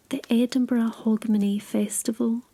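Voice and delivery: ordinary delivery, nothing marked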